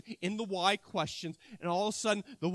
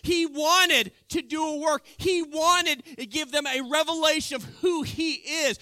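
louder, higher, driving home the point; fiery preaching; 4 semitones higher, 5 dB louder than usual. Real, not acted. Preacher proclaiming to the congregation, fiery. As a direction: preach it out with force.

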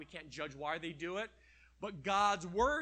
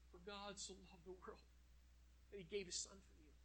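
soft, much quieter seductive murmur; devil whispering; 17 dB quieter, faster than usual. Acted. Devil whispers comfort to tempt us, soft murmur. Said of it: "Then he whispers to us".

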